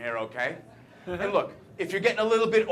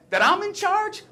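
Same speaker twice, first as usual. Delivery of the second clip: high and loud, mock-astonished; incredulous question; 6 dB louder than usual. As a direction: pitch leaps up in disbelief. Incredulously repeats others' notion.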